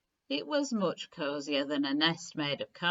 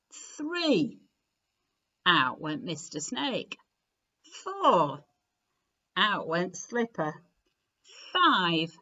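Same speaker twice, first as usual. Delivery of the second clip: usual speaking voice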